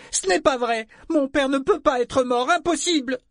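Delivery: very high, loud frantic shrieking; wild, high-pitched tantrum of denial; hysterical girl's denial. A narrator's reading. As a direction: shriek it out like a girl refusing terrible news. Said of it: "en agitant les poings comme une folle".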